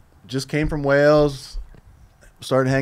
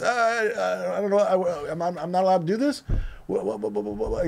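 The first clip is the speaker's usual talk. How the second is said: mock panicked stammer, repeating words, higher; stuttering; 7 semitones higher than usual. Young Swift stammering at his boss, mock panicked. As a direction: caught in the headlights, stumbling over words. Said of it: "You know, just stuttering".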